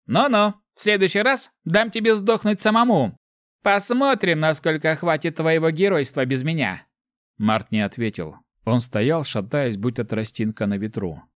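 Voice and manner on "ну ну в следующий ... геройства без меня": high, loud, taunting demon voice; Mefis, mocking. A narrator's reading. Inner demon threatening to abandon the hero, mocking. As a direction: threaten him with scornful amusement, pitched high. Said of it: "Мефис будто усмехнулся".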